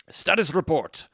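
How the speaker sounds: "Status report" in a loud hammed-up Russian accent; bad Russian accent. Gamer reading the memo title in a bad Russian accent. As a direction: bark "Status report" like a Soviet officer. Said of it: "terrible and potentially offensive Russian accent".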